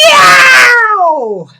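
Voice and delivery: howling yow; loud yow of pain. Acted. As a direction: shriek it like the wax just got ripped off. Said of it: "sorry if my yow was a bit too loud".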